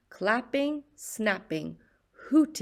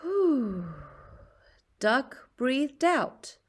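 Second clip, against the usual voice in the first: plain reading voice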